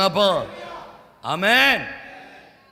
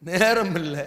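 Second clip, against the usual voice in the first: ordinary talk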